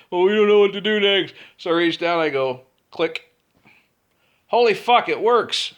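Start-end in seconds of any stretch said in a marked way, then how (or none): 0.1-1.3 high, loud whiny voice; mocking helpless crew. 4.5-5.7 high, loud fake amazement; mock astonishment. Mocking the crew's astonishment.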